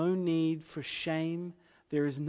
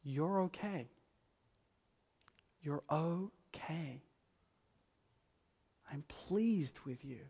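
softer, slow gentle reassurance; quiet, unhurried divine acceptance; tender voice of God; 2 semitones higher, 5 dB quieter, slower than usual. Acted. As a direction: murmur it like comforting a hurting friend. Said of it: "So God to come and say".

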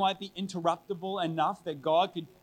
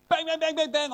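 loud mock banging; 11 semitones higher, 7 dB louder than usual. Knocking acted out in story.

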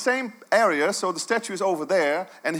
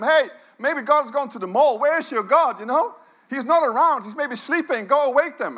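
higher, teasing taunt at the false prophets; mocking taunt; 6 semitones higher than usual. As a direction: needle them like a cheeky heckler. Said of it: "And it's just mocking".

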